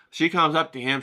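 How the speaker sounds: ordinary delivery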